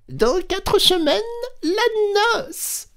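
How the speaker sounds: very high squeaky mouse voice. A narrator's reading. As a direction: pinch the voice up into a small mouse. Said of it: "lui dit la souris". (voix de souris très aiguë)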